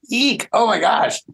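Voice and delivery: high, squealed mock alarm; a little shriek of alarm, then oh my gosh; playful startled squeal; startled eek. Real, not acted. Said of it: "speaker reacts to own example".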